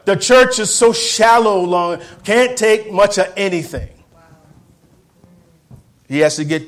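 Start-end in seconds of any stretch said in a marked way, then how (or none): 0.0-3.9 high, loud preaching call; preaching cry. Real, not acted. Preacher decrying the shallow church, high and loud. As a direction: cry it out high over the music.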